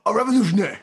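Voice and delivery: high, loud imitation of a revolutionary orator; mock rallying cry. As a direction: shout it like a chant at a rally. Quotes the film's speech.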